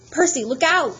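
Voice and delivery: high, loud warning shout; crying out. Acted. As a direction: warn him urgently. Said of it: "Annabeth cried".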